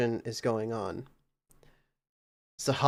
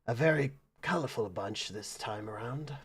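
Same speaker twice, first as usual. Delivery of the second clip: softer, lower-key aside; under his breath; 3 semitones lower, 7 dB quieter than usual. Acted. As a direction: say it quietly to yourself. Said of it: "you can hear under his breath".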